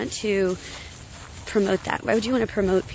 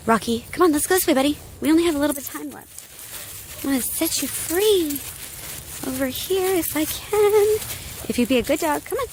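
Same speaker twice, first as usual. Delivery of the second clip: higher, sing-song voice for the dog; talking to the dog; 6 semitones higher than usual. Real, not acted. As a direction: coax the dog along like a pet. Talking sweetly to her dog.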